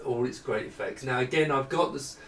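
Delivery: ordinary talk, nothing marked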